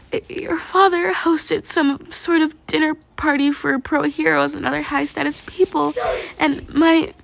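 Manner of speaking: put-on high, hushed girl's voice, breaking; a distressed girl confessing; tearful confession. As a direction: spill it out through rising tears.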